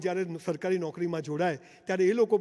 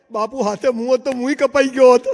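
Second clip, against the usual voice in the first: minister answering the king, a good deal louder; loud punchline reply; 3 semitones higher, 10 dB louder than usual. Acted. Minister to king, delivering the clever answer loudly. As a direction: land the punchline loud and proud.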